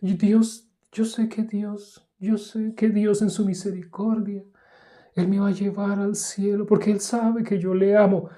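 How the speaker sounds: mocking a churchgoer's high, pious voice; voz aguda de piedad fingida. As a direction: raise the voice high and sweet like a self-righteous believer. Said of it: "se pone aguda, aguda, aguda".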